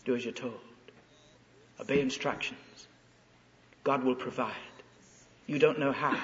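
soft, low, steady inner voice; quiet inner voice. Acted. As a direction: calmly repeat it like a gentle conscience. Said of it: "another little voice says".

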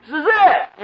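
high, loud, slow desperate cry; hammed-up terrified plea to the Lord; panicked disciples. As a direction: plead like men about to drown. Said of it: "They say".

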